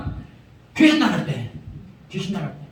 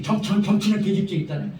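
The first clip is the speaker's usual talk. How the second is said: usual speaking voice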